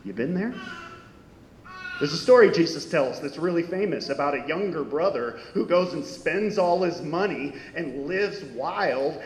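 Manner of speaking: normal talking voice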